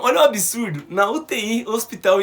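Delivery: normal talking voice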